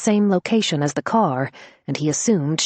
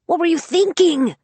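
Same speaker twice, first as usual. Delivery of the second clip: high, loud exasperation; exasperated cry; 13 semitones higher, 3 dB louder than usual. Acted. Vero crying out at her friend, high and loud. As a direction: cry out in disbelief. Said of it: "she cried".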